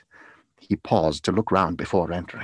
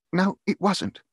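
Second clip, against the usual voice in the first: higher-pitched, earnest outburst; crying out; 10 semitones higher, 4 dB louder than usual. A narrator's reading. Grateful father telling his family, crying out. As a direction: cry it out with feeling. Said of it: "cried Bob".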